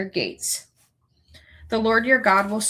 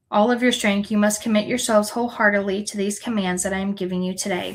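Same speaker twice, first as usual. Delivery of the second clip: normal talking voice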